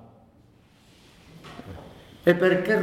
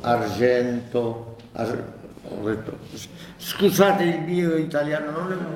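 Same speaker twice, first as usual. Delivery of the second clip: ordinary delivery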